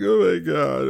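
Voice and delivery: high, loud wailed lament; mock crying. Telling how it felt at rock bottom, mock crying. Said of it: "that's how I sound when I cry".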